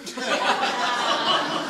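a real burst of laughter. Real, not acted. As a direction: laugh out at the absurdity. Laughing at own family joke.

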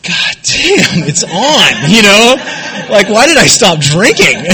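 high, loud and thrilled; buzzed excitement; elated. Retold or imitated. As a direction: relive the rush of the first beer. Joy of first beer.

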